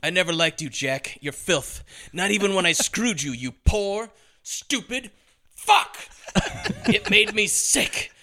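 high, far-off sneering villain voice, peaking in a shriek; high scornful femme fatale insulting Jack; Mona's spiteful farewell. Retold or imitated. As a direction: spit contempt at him, slowing on the last insults. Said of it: "she's like".

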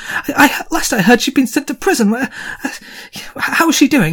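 high, breathless, thrilled questions; dwarf jumping up and down for news; excited dwarf. Acted. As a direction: bounce with joy as you ask. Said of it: "starts jumping up and down and goes".

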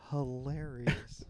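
slow, breathy whisper of delight; whispered praise. Real, not acted. Praising a friend's story, a slow whisper. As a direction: breathe the word out slowly.

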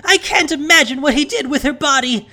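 high, loud tearful wail; wailing rant. A narrator's reading. Elsa ranting to the townsfolk, high and wailing. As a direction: pour out horror and blame in a wail. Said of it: "Elsa wailed".

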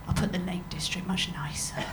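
conspiratorial whisper. Retold or imitated. Colleague whispering a fake record entry. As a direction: whisper it like a naughty secret. Retold colleague's sly comment.

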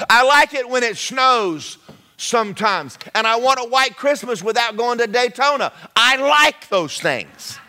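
loud, rising preacher's build; full-force preaching. Acted. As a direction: preach it out to the back row.

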